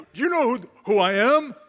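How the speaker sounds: a hammed-up angry royal rant; furious king. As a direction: play the outraged king demanding respect. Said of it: "King Uzziah was furious".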